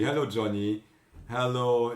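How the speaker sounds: ordinary talk, nothing marked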